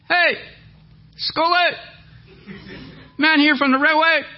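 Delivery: very high, loud holler; hollered public announcement; yelling across room. Retold or imitated. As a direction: call out so the whole shop hears. Man yells across the room.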